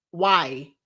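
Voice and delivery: ordinary talk, nothing marked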